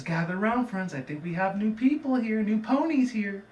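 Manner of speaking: a cult leader greeting new ponies; sugary Starlight Glimmer. Retold or imitated. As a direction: lure the newcomers in sweetly. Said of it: "she says".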